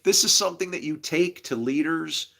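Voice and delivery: ordinary talk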